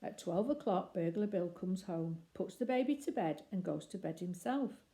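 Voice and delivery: plain reading voice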